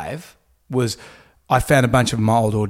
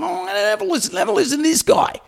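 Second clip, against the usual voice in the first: very high, mocking radio-host imitation; Kyle; faster than usual. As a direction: mimic a jeering host way up high. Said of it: "And then Kyle's going".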